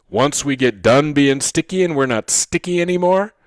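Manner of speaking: ordinary delivery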